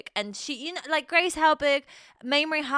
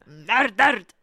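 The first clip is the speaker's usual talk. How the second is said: "Murdered" said louder in a put-on northern English accent; mock northern accent; 2 semitones lower, 7 dB louder than usual. Echoing the TikTok line for her co-host, in a jokey northern accent. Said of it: "That sounded kinda a little bit Scottish".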